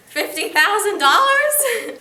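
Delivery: laughing, high disbelief. Acted. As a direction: laugh through the question. Mocks the bequest.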